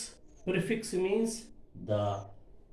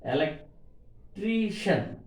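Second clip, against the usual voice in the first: very slow "Electrician", pausing before a long TRI; stress on TRI; 3 semitones higher, slower than usual. Shown as a demonstration. Teacher marking stress above T, slow "Electrician" stressing TRI. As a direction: break "Electrician" apart and lean on TRI. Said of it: "T above we have to stress".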